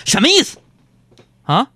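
high, loud indignant outburst; comic indignant yell at his wife; mock angry retort. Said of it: "我就生气了".

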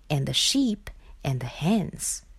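softly whispered; sneaking whisper. A narrator's reading. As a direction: whisper so the farmer stays asleep. Animals creep in quietly.

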